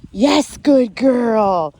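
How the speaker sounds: very slow drawn-out praise. Real, not acted. Trainer rewarding dog back in heel, very slow praise. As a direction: reward her with long words. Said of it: "praise after correction".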